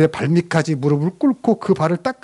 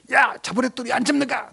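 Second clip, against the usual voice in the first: irritated father; 10 semitones higher than usual. Retold or imitated. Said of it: "신경질 내요".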